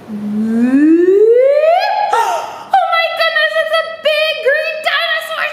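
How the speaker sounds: high, gasping pretend shock; mock surprise. Teacher hamming surprise at a pink snake for kids. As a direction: gasp and squeal as if startled. Acting surprise at book puppet.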